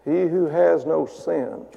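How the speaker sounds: slow, soft musing hum; pondering to oneself. Retold or imitated. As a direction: mull it over quietly. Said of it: "they started thinking like this".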